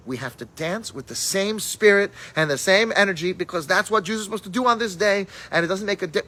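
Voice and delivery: loud, high rallying cry to dance; fiery rousing call. Retold or imitated. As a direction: rouse the students, full voice. Rabbi rousing his students to dance.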